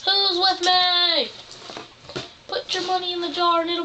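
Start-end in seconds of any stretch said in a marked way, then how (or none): 0.1-1.3 slow, drawn-out rally cry; rallying call. Pretend. Meeting leader asks everyone to donate, rallying call. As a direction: stretch it out to rally the group.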